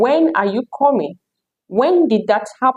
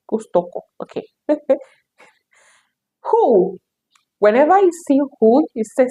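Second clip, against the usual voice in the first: ordinary delivery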